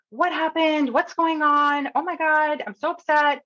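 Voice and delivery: high, mock-distressed stranger's voice; high imitation of a flustered passenger; distressed woman. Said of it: "this person looks really distressed".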